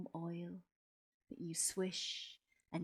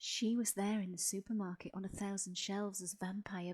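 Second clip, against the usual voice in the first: plain reading voice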